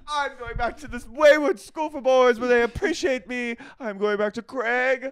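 high, loud huffy declaration; storming off. Pretend. Imagined star quitting the show, high loud flounce. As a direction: stomp away wounded and proud. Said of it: "He goes".

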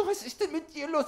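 high, cooing voice for a dog; Hundestimme. Retold or imitated. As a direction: coo at the dog like at a baby. Talking to the golden retriever. (hohe Babystimme für den Hund)

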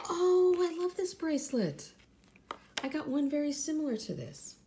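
high, loud burst of delight. Real, not acted. Vlogger pulls a bracelet from her prize box, delighted. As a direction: gush with a big high "Oh".

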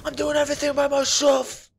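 high, loud whining; whiny complaint. Real, not acted. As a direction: whine that nobody helps. Complaining about teammates.

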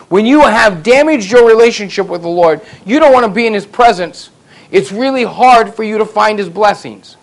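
loud, high, driving sermon delivery; preaching at full force. Acted. Preacher urging the congregation about guilt, loud and rising.

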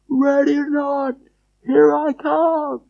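very high, drawn-out calling; far-off falsetto holler; distant call. Acted. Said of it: "then you hear him go".

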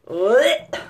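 loud, short mock gag; disgusted retch noise. Shown as a demonstration. Recalling gross mouth guard impressions, disgusted blech. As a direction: blurt out a yucky retch.

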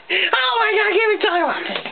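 ordinary delivery

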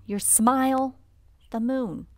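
usual speaking voice

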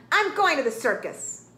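loud, determined rooster outburst; storming rooster. A narrator's reading. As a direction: declare it in a huff.

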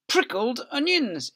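high, loud, slow storybook voice; reading to children. Acted. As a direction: read it out big and lively for little ones. Reading the picture book aloud.

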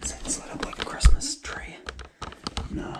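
hushed whisper. Real, not acted. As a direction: whisper so nobody hears. Hiding GoPro, sneaking in hallway.

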